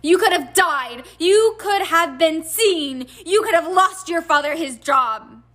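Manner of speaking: high, loud, furious reproach; shouted scolding. A narrator's reading. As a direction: shout each accusation at your son. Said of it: "Missus Weasley had shouted herself hoarse".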